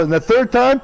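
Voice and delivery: ordinary delivery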